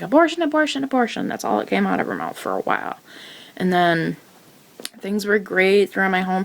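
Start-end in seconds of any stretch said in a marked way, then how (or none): 0.0-1.3 higher mocking chant of mom's insistence; high mock mother repeating one word; imitating mom. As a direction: parrot mom's demand in a high voice. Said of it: "She's like".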